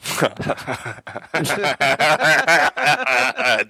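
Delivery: evil villain cackle; diabolical laugh. Acted. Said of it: "Diabolisches Lachen".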